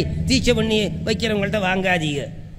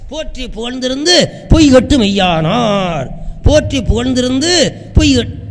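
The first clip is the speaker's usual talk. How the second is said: much louder, rising sermon climax; fervent preaching; 3 semitones higher, 6 dB louder than usual. Acted. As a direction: preach it at full force. Climactic preaching, loud exclamations. (உச்சக்கட்ட உரத்த பிரசங்கம்)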